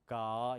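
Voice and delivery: low, long, voiced "gaa" for 가; American-style 가. Shown as a demonstration. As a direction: draw out 가 low as an American hard g. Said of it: "미국 사람들은 그렇게 못 읽고". (미국식으로 낮고 길게 끄는 가)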